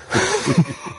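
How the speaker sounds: high-pitched burst of laughter; amused laugh. Real, not acted. Guest cracks up after the Iran joke, amused laugh.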